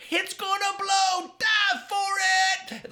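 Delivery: very high, slow loud yell; panicked shout. Acted. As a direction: scream the warning. Machine about to explode.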